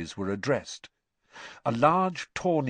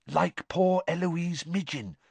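usual speaking voice

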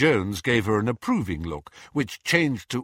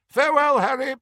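high, loud character voice; farewelling witch; 6 dB louder, faster than usual. A narrator's reading. Hestia clasping Harry's hand in parting, high and emphatic. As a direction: call the farewell out at a high pitch.

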